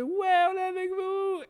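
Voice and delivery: high mock supportive voice; high friendly liberal. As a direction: cheer them on eagerly. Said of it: "il y a un gars qui fait".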